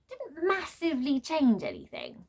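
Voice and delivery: usual speaking voice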